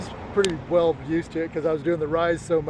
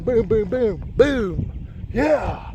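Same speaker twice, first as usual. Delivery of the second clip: higher, punchy impact noises into a cheer; excited re-enactment; 3 semitones higher, much slower than usual. Retold or imitated. Runner racing back down, excited booms. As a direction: act out pounding steps and a whoop.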